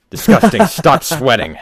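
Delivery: short high mock screech; reprised Examiner screech. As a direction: toss off the Examiner shriek again.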